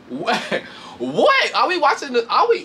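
high, rising disbelief; incredulous. Retold or imitated. Reacting in disbelief to the bragging, high and rising. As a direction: act stunned at them. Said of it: "And I'm like".

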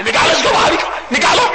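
high shouted command; angry order. Acted. Imam orders worshipper removed from mosque, angry shout. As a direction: shout for him to be thrown out.

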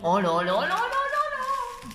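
very high, loud, slow oh là là; rapturous oh là là. Real, not acted. Vlogger overwhelmed by a candle's scent, very high slow rapture. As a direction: sing out the oh là là in rapture.

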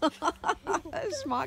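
"Schmacko" giggled out playfully; laughing. Real, not acted. Mom laughing at the word.

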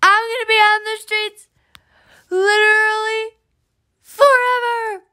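fake-cry it, over the top; mock wail. Homeless character wails about the streets forever, mock crying.

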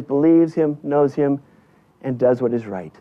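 usual speaking voice

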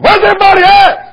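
high, loud preacher's cry; shouted sermon title. Retold or imitated. Young preacher crying out his sermon title, loud and high. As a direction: call it out to the empty pews. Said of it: "preached like a wild man".

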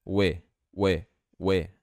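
WE with an open "eh" vowel, "weh"; the start of "with", WE sounding like "weh". Shown as a demonstration. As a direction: say WE as a short "weh", no final sound. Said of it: "Esse I vai ficar com som de E".

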